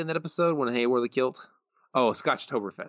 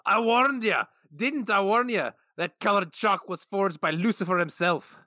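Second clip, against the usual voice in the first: Willie voice; 9 semitones higher, slower than usual. Retold or imitated. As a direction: growl it out like a Scottish janitor. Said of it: "in the Willie voice".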